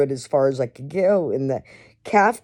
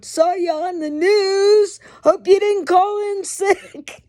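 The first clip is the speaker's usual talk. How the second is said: much higher, slow teasing singsong; mocking coworkers; 14 semitones higher than usual. Coworkers heading to chow tease him, high slow mocking voice. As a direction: needle him like a smirking coworker. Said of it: "They're going to chow, going".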